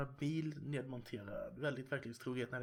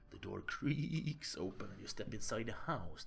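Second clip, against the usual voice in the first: ordinary talk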